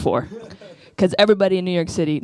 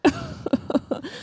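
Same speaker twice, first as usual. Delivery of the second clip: high squeaky laugh; high girlish giggle; 5 dB quieter than usual.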